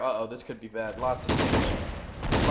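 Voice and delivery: normal talking voice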